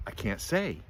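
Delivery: ordinary delivery, nothing marked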